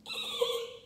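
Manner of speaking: high squeaky dolphin call; dolphin squeak. Shown as a demonstration. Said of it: "make your dolphin noise".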